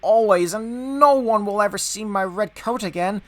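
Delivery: high-pitched, woeful lament; sad. A narrator's reading. Engine shut away in the shed, sadly lamenting. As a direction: wail it gently, pitched well up, despairing. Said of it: "he thought sadly".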